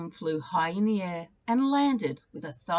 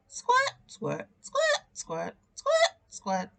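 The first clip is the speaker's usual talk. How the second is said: squirt sound; much slower than usual. Acted. Milk squirting sound effect.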